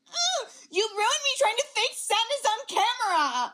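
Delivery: mocking imitation. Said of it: "she was just like".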